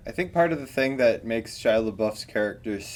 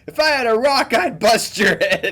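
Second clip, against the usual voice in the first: high, hammed-up comic threat; imitated movie character railing at his grandma; Bernie Mac's character; 10 semitones higher, 4 dB louder than usual. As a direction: threaten grandma in a raised, mock-irate voice. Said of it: "when Bernie Mac's character's, like, telling his grandma".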